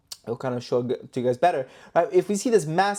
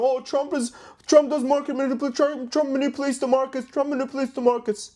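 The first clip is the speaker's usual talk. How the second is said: high, hurried parody voice; mocking complainer; 8 semitones higher than usual. YouTuber imitates critics crying market manipulation, high mocking voice. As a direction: mimic someone harping on the same complaint.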